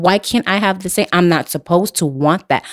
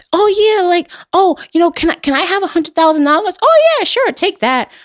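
mock sugary ask, pitched much higher; mock eager asking; 11 semitones higher than usual. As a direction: play a too-easy request, high and breezy. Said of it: "if I was just like".

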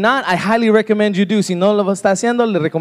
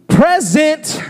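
drawn-out, higher-pitched call; student answering roll; 6 semitones higher than usual. Acted. Student answers the teacher's roll call, calling out. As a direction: call it out slowly so you're counted. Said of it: "you had to shout".

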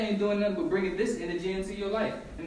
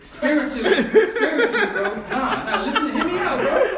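high laughing protest, getting louder; tipsy bar argument; 7 semitones higher, 5 dB louder, slower than usual. Real, not acted. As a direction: laugh it out, pitching up. Laughing tipsy banter, rising.

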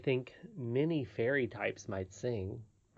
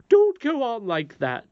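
high, loud, drawn-out scolding; almost fierce; 11 semitones higher, 10 dB louder, slower than usual. Acted. Nurse silencing the boy, almost fiercely. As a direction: rebuke him sharply as a nurse. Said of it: "said the nurse to the boy almost fiercely".

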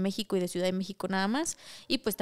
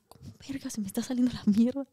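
panicked unvoiced whisper; horrified whisper. Losing control of her body on the floor, a horrified whisper. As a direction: hiss it in shock to yourself.

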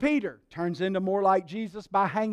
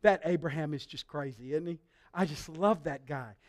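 low, soft, affectionate aside; fond confiding; 7 semitones lower, 7 dB quieter than usual. Retold or imitated. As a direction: marvel fondly, almost to yourself. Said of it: "And he just was like".

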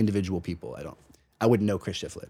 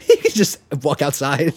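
laughing; 5 semitones higher, 6 dB louder than usual. Real, not acted. Joking about the strap-on remark.

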